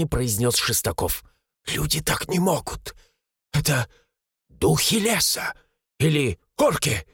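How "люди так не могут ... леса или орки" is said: halting, frightened guesses with pauses; teeth-chattering fear. A narrator's reading. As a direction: break it up as if too scared to speak. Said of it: "стуча зубами". (испуганно, с запинками)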